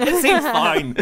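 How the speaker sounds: high, light, laughing; airily brushing off the danger; mock breezy character voice. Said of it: "about to be like".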